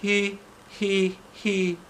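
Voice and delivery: a forced giggle that won't come; miserable he-he. Acted. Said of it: "she said miserably".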